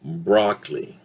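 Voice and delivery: BROCCOLI as BROCK-lee; no middle O in BROCCOLI; reduced vowel. Shown as a demonstration. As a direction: model two-syllable BROCCOLI. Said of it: "repeat after me".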